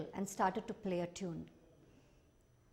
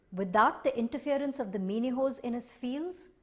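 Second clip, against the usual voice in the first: plain reading voice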